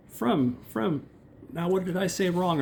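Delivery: high plaintive calling after him; wife calling. Retold or imitated. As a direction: call him back, puzzled. Wife calls after husband.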